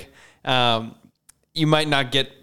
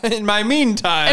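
laughing repeat; 8 semitones higher, 3 dB louder, slower than usual. Real, not acted. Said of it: "That's good".

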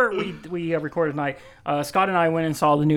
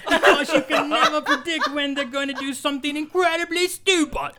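higher, laughing pirate captain impression; Jack Sparrow voice; 7 semitones higher than usual. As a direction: ham up a pirate rogue, breaking into laughs. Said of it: "I'm Jack Sparrow".

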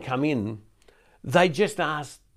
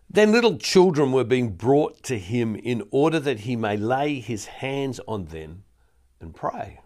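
scripture reading. Acted.

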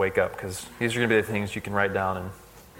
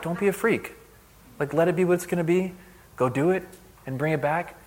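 humorous call-out; 5 semitones higher than usual. Retold or imitated. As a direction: gently recap the joking call-out. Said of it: "call out in a way that everyone could laugh".

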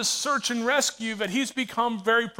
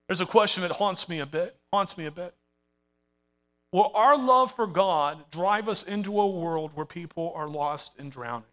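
usual speaking voice

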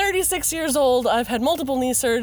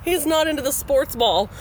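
normal talking voice

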